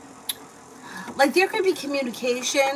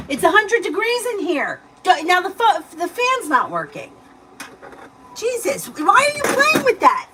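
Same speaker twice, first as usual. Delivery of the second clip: scolding; 6 semitones higher, 5 dB louder than usual. Real, not acted. Mom scolding child for unplugging fan.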